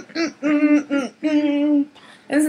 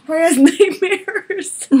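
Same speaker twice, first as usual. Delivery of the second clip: higher and laughing; giggling through the words; 8 semitones higher, slower than usual. Real, not acted. Still laughing at friend.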